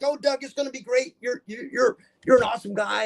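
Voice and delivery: high, gushing praise; cheerful boss. Retold or imitated. Nurse hiring Doug, high and gushing. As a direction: sweet-talk the new hire. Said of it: "She's like".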